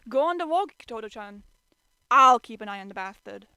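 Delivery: loud, gruff order; gruff shout. A narrator's reading. Kyo telling Toru to go to work, loud gruff. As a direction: bark it out.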